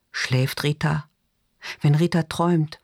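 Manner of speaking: ordinary delivery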